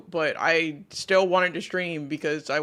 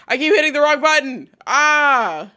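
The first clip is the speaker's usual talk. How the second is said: frustrated outburst; 8 semitones higher, 10 dB louder than usual. Real, not acted. Frustrated with wrong button.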